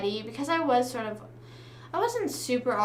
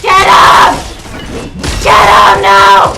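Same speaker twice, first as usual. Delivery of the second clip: loud urgent shout; 3 semitones lower, 21 dB louder, slower than usual. Real, not acted. Gamer urging teammates mid fight, loud shout. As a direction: yell at your teammates to attack.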